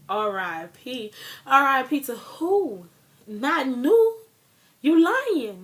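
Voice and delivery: loud, high-pitched disbelief at bad news; disbelieving outcry. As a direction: shout it out in shock and denial. Shocked disbelief at RIP post.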